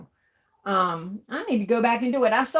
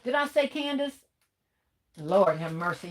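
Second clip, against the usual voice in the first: usual speaking voice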